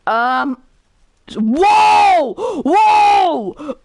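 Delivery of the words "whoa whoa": high, loud cry of shock; sudden loud whoa; alarmed shout. Real, not acted. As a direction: yell it out, startled. Alarmed reaction to game's word choices.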